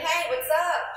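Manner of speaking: loud, breezy street hello; casual what's up; casual slangy greeting. Shown as a demonstration. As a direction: greet like a buddy. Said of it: "instead of saying".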